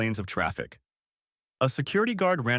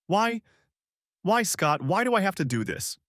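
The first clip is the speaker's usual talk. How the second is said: anguished why; 9 semitones higher than usual. Acted. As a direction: cry out in self-loathing. Barrage of self-loathing.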